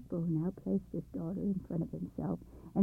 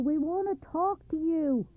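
shout; 8 semitones higher, 5 dB louder than usual. Acted. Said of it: "shouted Muggle".

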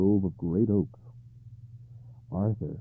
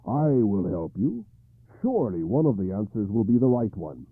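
confident knight; 7 dB louder than usual. Acted. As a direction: promise your help with assurance. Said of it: "said Gawain confidently".

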